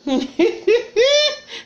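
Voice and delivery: high shrieking burst of laughter; real squealing laugh. Real, not acted. Laughing at breastfeeding story.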